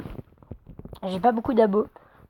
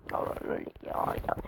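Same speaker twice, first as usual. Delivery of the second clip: whispering; 14 dB quieter than usual. Real, not acted. Whispered aside to viewers.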